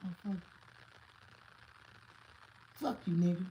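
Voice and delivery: put-on high, bratty kid's voice cursing. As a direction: spit it like a defiant little boy. Kid cursing at him.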